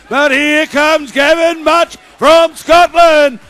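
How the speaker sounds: loud, rising race call as the Scotsman surges; excited shouting commentary. Real, not acted. Commentator roaring the Scotsman on, loud and excited. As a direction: shout the leader home with slow, stretched words.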